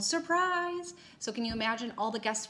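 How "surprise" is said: mock surprise yell. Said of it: "jumped out and yelled".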